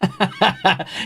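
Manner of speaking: bubbling high laugh; laughter. Real, not acted. Tickled by the cat's silly noise, laughing. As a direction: crack up in a quick run.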